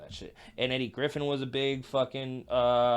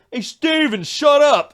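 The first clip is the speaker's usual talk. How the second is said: high, loud sleep-talk shout; mock telling-off; 11 semitones higher, 11 dB louder, slower than usual. Sleep talker silencing himself, loud mock yell. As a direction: shout yourself down as your dad would. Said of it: "I'm telling myself to shut up".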